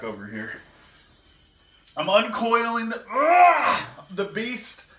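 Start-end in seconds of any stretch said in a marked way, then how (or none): 2.0-4.9 high strained grunting as if wrestling a snake; mock struggle. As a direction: fight the imaginary python, straining high. Fake struggle uncoiling the python.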